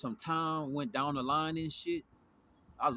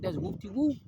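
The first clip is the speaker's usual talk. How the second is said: surprised recognition; 7 semitones higher, 3 dB louder than usual. Retold or imitated. Recognizing him from the van.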